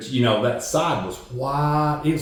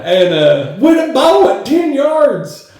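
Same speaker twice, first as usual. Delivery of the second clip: laughing exasperation; 12 semitones higher, 6 dB louder, slower than usual. Retold or imitated.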